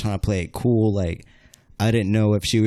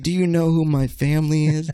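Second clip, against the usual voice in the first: imitating a challenge in raised pitch; retold threat; 6 semitones higher than usual. Sister choking him outside the bar, challenging. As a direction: throw down a mock threat, higher-pitched.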